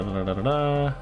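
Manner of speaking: usual speaking voice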